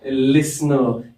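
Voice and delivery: slow, low "लिसनर" with no T; "लिसनर" said slowly, S straight into N; silent T. Shown as a demonstration. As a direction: draw out "लिसनर", skipping the T. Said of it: "इसका जो टी होता है".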